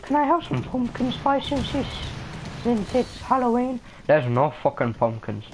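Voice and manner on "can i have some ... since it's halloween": high-pitched imitation of a woman asking; mock woman's voice. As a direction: mimic her in a squeaky-high put-on voice. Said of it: "she's like".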